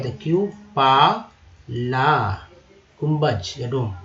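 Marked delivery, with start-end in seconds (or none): 0.2-2.3 क्यू पा ला said slowly, each part separate; syllable by syllable. Shown as a demonstration. Teacher splitting the word for learners, slowly. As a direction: break क्यू पा ला into slow parts. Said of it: "ठीक है।".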